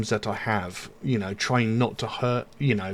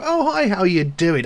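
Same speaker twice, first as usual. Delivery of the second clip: mock bright hello, pitched higher and louder; bumping into someone, a put-on cheerful hello; fake friendly greeting; 8 semitones higher, 5 dB louder than usual. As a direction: greet them with a forced smile. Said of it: "the fake smile".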